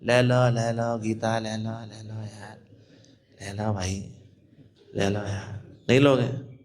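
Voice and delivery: low, lifeless, fading mumble; limp listless devotee. Retold or imitated. Mocking a lifeless devotee, in a limp drone. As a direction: sell the book like you've given up. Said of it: "मरगिल्ले तरीके से जाकर के".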